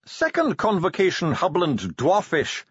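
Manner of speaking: high, measured dwarf voice; identify it in a lifted scholarly voice; Bashfulson, dwarf scholar. A narrator's reading. Dwarf identifies the box's language, high and precise.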